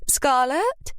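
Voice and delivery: calling from another room. Acted. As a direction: call her name, rising. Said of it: "Lily called from the kitchen".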